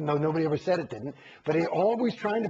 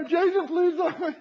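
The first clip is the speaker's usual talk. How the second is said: mock pleading; 10 semitones higher, 3 dB louder than usual. Mimicking a pleading official.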